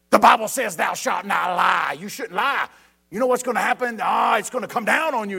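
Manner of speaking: loud barking mock parent citing scripture; harsh scolding parent. Mocking a parent who scolds a child with a verse, loud. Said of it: "they throw in a verse".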